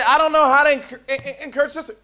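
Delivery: high, loud and stammering; a preacher mimicking a flustered brother; mock flustered voice. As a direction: stammer it like a nervous guy. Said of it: "And you say".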